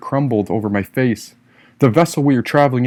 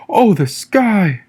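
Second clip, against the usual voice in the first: awed exclamation; 7 semitones higher, 3 dB louder, much slower than usual. A narrator's reading. Narrator awed by the open sky.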